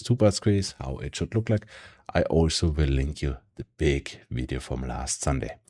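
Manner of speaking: ordinary delivery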